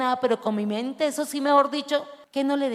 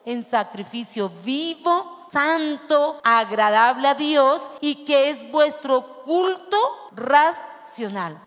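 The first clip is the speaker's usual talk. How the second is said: slow, weighty reading of the verse; scripture reading; 4 dB louder, slower than usual. Acted. Preacher reading Romans to the church, slow and solemn. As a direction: read the Bible verse slowly to the congregation.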